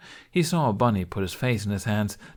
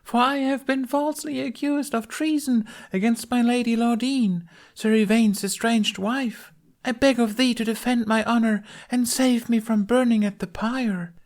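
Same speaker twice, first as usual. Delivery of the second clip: hammed-up falsetto plea; theatrical lament; 4 dB louder than usual. A narrator's reading. Bunny as Lunette begs to be saved, lamenting high. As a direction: plead like a stage damsel, very high. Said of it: "Bunny lamented".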